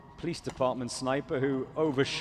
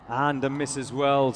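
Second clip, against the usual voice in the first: ordinary talk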